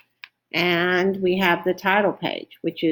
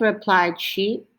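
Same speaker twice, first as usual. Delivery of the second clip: ordinary delivery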